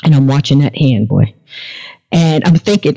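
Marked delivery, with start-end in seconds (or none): none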